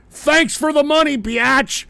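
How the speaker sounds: high, loud gloating taunt; mock gloating. Trader to investors he cashed out on, loud mock gloating. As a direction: crow it at the losers you fleeced. Acts out Roaring Kitty gloating.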